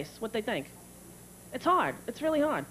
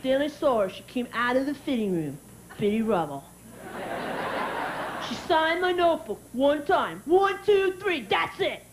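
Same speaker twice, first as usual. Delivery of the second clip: mock kid telling a big story; bragging child. Nine-year-old bragging at school, emphatic child voice. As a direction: boast like a kid, punching it.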